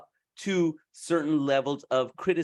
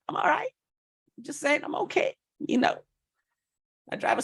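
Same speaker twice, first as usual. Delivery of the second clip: squeaky, sheepish high voice; high self-mocking voice; 13 semitones higher, faster than usual. Real, not acted. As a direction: pitch way up, playfully sheepish. Joking about his looks.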